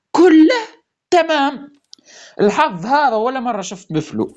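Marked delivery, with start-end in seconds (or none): 0.1-1.8 high, loud shout of joy; joyful shout. Real, not acted. Player celebrating the luck, high loud shout. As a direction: belt it out in triumph.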